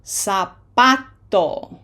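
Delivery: Zapato begun with an S sound, slowly. Shown as a demonstration. Teacher modelling Zapato for learners, S sound instead of Z. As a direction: say Zapato as sa-PA-to, stretched out. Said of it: "No Z sound".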